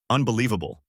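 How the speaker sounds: usual speaking voice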